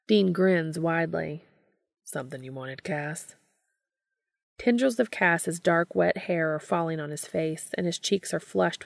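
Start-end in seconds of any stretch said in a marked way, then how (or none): 2.0-3.4 hushed, teasing question; low soft tease with a grin. Acted. Teasing from below.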